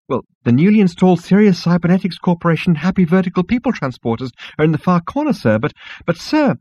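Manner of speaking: high voice ending in a squeaked "sir"; flustered insect receptionist. Acted. Insect receptionist directs Zaphod to the lifts, flustered. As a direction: give directions, then call after him anxiously.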